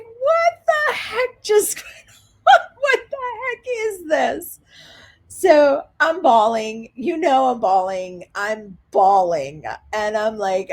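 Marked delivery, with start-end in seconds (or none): 0.0-4.6 high, drawn-out squeal of mock disbelief; stunned disbelief. Guest left alone after officers close the door, high-pitched bewilderment. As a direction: squeak it out slowly, nearly laughing.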